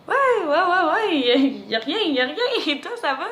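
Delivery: high, loud flustered babble; flustered, ill at ease. Retold or imitated. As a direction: act out an over-eager, awkward reply. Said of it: "mal à l'aise".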